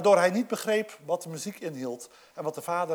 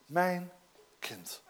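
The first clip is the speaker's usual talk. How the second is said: zacht en teder; 3 semitones lower, 9 dB quieter than usual. Acted. Father's tender address.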